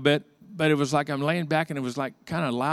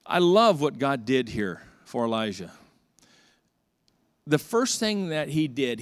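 usual speaking voice

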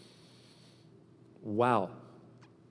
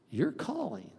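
dropping to a softer, intimate your calling; softer repeat; 8 semitones higher, 6 dB quieter, slower than usual. Shown as a demonstration. Pastor pressing the point home, softly. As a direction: say your calling again, hushed and earnest.

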